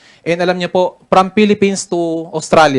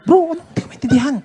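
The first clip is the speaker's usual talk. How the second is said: high-pitched helpless plea; frantic whisper-plea to his mentor at immigration; panicked plea; 11 semitones higher than usual. Retold or imitated. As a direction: beg your mentor for help, pitched way up. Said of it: "sabi ko ngayon kay Mentor Eli Boy".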